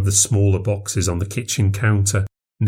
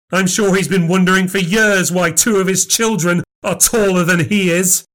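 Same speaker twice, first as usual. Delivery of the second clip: gloating threat; 8 semitones higher than usual. Acted. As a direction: savour the threat.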